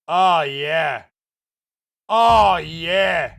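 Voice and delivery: loud drawn-out oh yeah, rising to a shout; hammed-up oh yeah. Streamer to chat, hammed-up loud oh yeah. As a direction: savor it like a cheesy ad.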